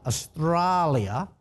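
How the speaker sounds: AUSTRALIA stretched out slowly in a put-on Australian accent; best Australian accent. Shown as a demonstration. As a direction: draw out "Australia" in a showy Aussie accent. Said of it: "that's me good, bestest Australian accent".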